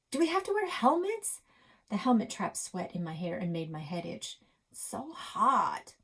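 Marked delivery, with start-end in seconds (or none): 0.1-1.4 put-on much higher, pleading little girl; high complaining child; whiny kid voice. Krista voiced asking Grandpa. 4.7-5.9 slow, higher drawn-out whine; whiny complaint. Acted. Krista complaining about helmet heat.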